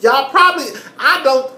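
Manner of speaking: loud, high burst of anger; loud outburst. Real, not acted. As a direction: blurt it out loud. Ranting peak.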